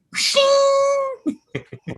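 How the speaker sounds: loud, high whooshing slash; imitated slashing noise. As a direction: make a long swishing claw-swipe sound. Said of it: "like Freddy Krueger just went".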